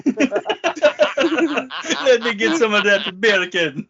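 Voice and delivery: laughing, Jamaican-style beer can; Jamaican accent. Pretend. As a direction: tease in a Jamaican lilt. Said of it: "like a Jamaican saying bacon".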